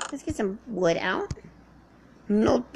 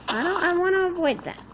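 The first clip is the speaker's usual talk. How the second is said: higher, louder, stressing want; emphatic; 8 semitones higher, 4 dB louder than usual. Real, not acted. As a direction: push it out insistently. Repeats line louder then softer.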